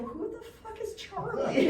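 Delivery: high, incredulous, half laughing; shocked retort. Retold or imitated. Comic baffled when grandma asks about Charlie, high incredulous blurt. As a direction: blurt it in disbelief.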